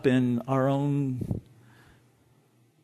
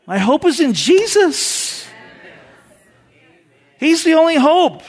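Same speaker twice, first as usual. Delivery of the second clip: higher and louder, driving preacher's cadence; preaching at full force; 8 semitones higher, 8 dB louder than usual. Acted. Pastor rallying the congregation, preaching at full force. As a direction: build it up and preach it to the back row.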